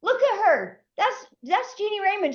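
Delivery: high, excited bragging; a giddy man bragging to the cops about her; excited boasting. Retold or imitated. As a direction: show her off, pitched high. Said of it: "he's saying".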